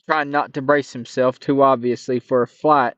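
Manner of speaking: plain reading voice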